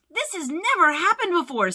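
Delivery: high, loud exclamation of dismay; alarmed. A narrator's reading. Smitty reacting to the falling meter, alarmed. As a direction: blurt it out in alarm.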